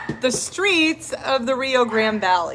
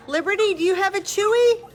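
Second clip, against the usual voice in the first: high, quick doggy talk; 8 semitones higher, faster than usual. Real, not acted. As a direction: coo at the puppy. Talking to a dog.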